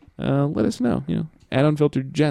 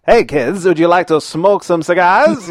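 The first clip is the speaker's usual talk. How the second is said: slow, louder, higher vintage ad announcer; old-timey commercial voice; 4 semitones higher, 5 dB louder, slower than usual. Retold or imitated. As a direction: sell it like a 1950s TV pitchman. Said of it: "the old-timey voice".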